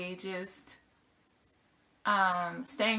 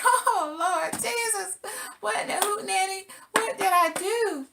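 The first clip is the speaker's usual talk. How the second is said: mock panic, high and stretched out; panicked cry; 11 semitones higher than usual. Panicked retelling of runaway skates.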